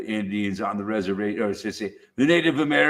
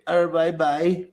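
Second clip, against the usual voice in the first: higher-pitched mock Australian; Australian accent; 6 semitones higher, slower than usual. Showing how Australians say Hervey Bay, Australian accent. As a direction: put on an Aussie accent for the place name. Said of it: "That's how the Australians would say".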